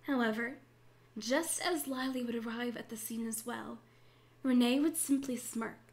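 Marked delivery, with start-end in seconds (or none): none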